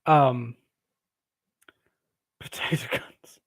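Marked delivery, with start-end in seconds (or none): 2.4-3.4 whispered "potato guns" under her breath; whispered to herself. Real, not acted. Host mulling a viewer's odd phrase, whispering.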